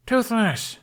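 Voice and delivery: high, loud call across the cove. Acted. Hiccup calls to Toothless on arriving, loud glad call. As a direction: call the dragon joyfully from afar.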